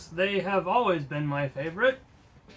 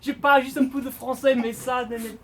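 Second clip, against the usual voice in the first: high, loud hammy French voice; exaggerated French accent, pushed up high; 8 semitones higher, 4 dB louder than usual. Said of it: "I don't know if this is French accent".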